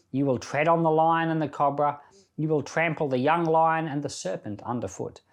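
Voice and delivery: ordinary talk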